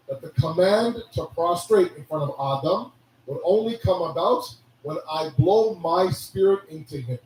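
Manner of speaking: normal talking voice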